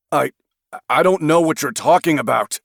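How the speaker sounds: stammering. A narrator's reading. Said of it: "Craig stammered".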